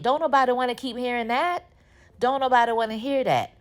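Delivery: high-pitched, fed-up scolding; indignant scolding. Real, not acted. Viewer scolding Fatima about her ex, indignant.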